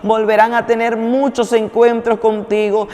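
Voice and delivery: preacher praying over the listeners, rising; fervent prayer. Acted. Preacher praying for every viewer, growing fervent. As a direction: pray with growing fervor.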